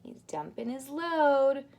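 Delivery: ordinary delivery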